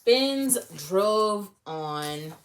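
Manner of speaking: ordinary delivery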